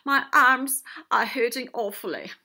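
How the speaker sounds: loud, hammed-up moan of pain; woeful octopus at full volume. A narrator's reading. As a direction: complain loudly about aching arms. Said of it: "Octopus moaned".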